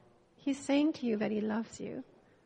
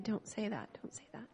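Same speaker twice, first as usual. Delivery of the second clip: soft, dropping to a whisper; hushed retold refusal; 2 semitones lower, 10 dB quieter than usual. Wife unable to receive husband's love, hushed refusal. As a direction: quietly wave the words away, fading out.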